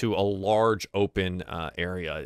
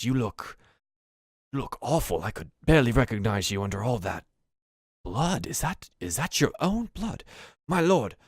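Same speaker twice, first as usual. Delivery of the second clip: put-on old goblin butler, deferential. Butler sees his bloodied lord home, deferential then worried. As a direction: fuss over your master, pitch rising with worry.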